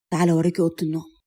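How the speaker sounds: a low, quick invitation; lightly voiced seductive man. A narrator's reading. Man's invitation voiced in story.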